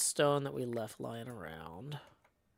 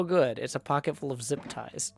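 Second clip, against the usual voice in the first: normal talking voice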